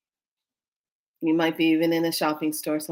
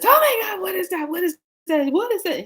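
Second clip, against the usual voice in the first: high-pitched fake alarm; mock panic; 12 semitones higher, 3 dB louder than usual. Woman pranking husband by pool, mock panic. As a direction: burst out high, as if horrified.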